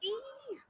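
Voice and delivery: very high, tight squeak of effort; real pained squeal while stretching; strained squeak. Real, not acted. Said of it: "Why am I struggling?".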